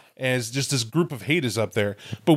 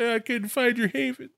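mock whiny Kosa; 10 semitones higher than usual. Mocking Kosa's excuse to Dorn.

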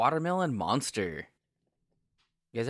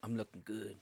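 low-key, much softer sensual line; deep sensual voice; 3 semitones lower, 12 dB quieter than usual. Real, not acted. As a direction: drop it soft and smooth, deep-voiced. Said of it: "You said it with a Mexican accent".

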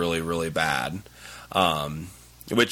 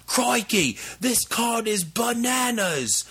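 hammed-up Australian accent, much higher; a clumsy tourist's Australian, slow and pitched up on bananas; bad Australian accent; 14 semitones higher, slower than usual. Joking with an opponent about the card, in a bad Australian accent. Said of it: "how bad I just butchered your accent".

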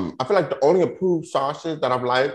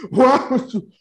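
put-on higher, louder upset kid; higher upset child's protest; angry child; 11 semitones higher, 5 dB louder, slower than usual. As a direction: demand it like a wronged child. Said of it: "I was, like, angry, but crying".

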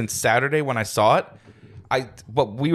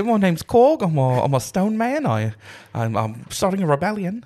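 put-on higher-pitched New Zealand accent, Taika Waititi style; Korg; 4 semitones higher than usual. Co-host introducing himself as a stone man, New Zealand accent. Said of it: "a little bit Taika Waititi".

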